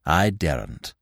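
low, soft, feeble voice; subdued, faint-hearted uncle; timid refusal. A narrator's reading. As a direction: admit it softly, nerve gone. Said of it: "he repeated".

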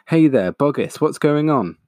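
high, loud call across the crater. Acted. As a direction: call down from the rim. Villager calls into the crater.

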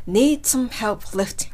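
slow careful repeat; slow model line. Shown as a demonstration. Teacher repeating the line for students, slow. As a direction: read it out slowly for learners. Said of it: "今の私の発音でどうでしょうか".

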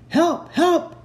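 loud, high acted cry; high-pitched storybook shout for rescue; crying for help. A narrator's reading. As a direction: yell out as if trapped. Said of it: "he cried in a gruff voice".

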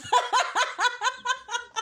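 high villainous cackle; evil laugh. Pretend. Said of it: "¿Escucharon esa risa malvada?".

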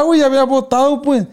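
ordinary talk

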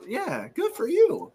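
high, slow, sing-song teasing "good for you"; mock praise. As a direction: tease him slowly, way up high. Teasing co-host about math.

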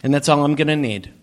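ordinary talk, nothing marked

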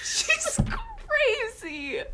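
high giggling breaking the words; can't stop laughing. Real, not acted. Friends cracking up over the fight scene, high laughter.

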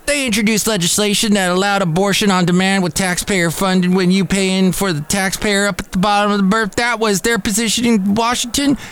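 high-pitched, ranting Southern senator impression; mock Southern accent. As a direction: rattle it off in an exaggerated Southern accent. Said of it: "that awful Southern accent".

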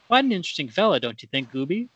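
normal talking voice

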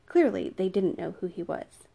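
usual speaking voice

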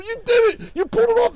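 loud, very high cheering imitation; retold high, loud congratulations; mock congratulating. Retold crowd congratulating him.

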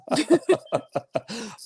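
high bright burst of laughter. Real, not acted. Laughs after kids bit.

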